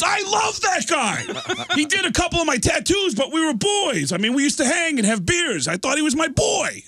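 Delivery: very high, hammed-up wailing; anguished yelling. Drummer lamenting his tattoo-artist friend, anguished yelling. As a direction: cry out high in mock betrayal.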